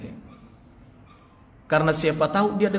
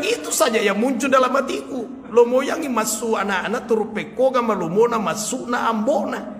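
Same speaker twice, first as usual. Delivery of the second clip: quoting him, higher, through laughter; laughing; 6 semitones higher than usual. Retold or imitated. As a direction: recite his prayer while giggling. Retold answer, laughing. (mengutip sambil tertawa)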